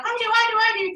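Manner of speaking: high, excited chorus; eager hands-up voices; eager guests. Retold or imitated. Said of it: "they're all like".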